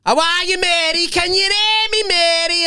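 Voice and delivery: cousin's wife mocked for shouting at dying nan; mock loud bedside talk. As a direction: mimic someone shouting kindly at a deaf patient. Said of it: "not deaf, lad".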